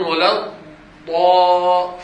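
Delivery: very slow, loud stretched ولا الضا; exaggerated wrong chant; mock broken recitation. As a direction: drag the doubled dad out mockingly. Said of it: "يقولون".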